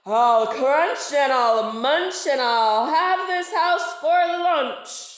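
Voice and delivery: loud, slow shark threat; shark shout. Acted. Shark threatens the narwhal's house with a loud, slow shout. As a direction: bellow it like a greedy shark.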